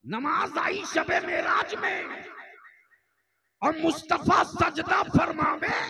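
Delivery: high, ringing pulpit shout; preacher's shouted declamation. Real, not acted. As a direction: proclaim it far above talking pitch.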